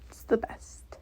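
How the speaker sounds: soft secretive whisper; whispered aside. Real, not acted. Whispered aside about avocado oil.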